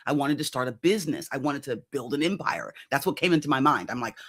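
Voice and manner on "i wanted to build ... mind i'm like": normal talking voice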